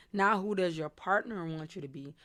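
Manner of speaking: normal talking voice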